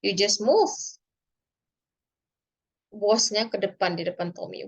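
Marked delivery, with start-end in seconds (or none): none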